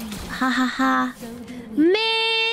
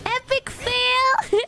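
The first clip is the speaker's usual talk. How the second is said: slow, drawn-out mocking call; 6 semitones higher, much slower than usual. Real, not acted. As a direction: stretch it out in mock disappointment. Gamer mocking a failed play.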